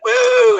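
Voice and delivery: very high, loud drawn-out "woo"; mock celebrity whoop. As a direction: let out a piercing imitation party whoop. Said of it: "Jenny McCarthy's just like".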